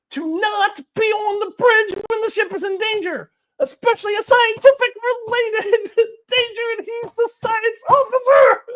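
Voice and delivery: high-pitched rant climbing into a yell; losing control. Real, not acted. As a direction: voice way up high, exasperated and cracking into laughter. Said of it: "I'm losing control again".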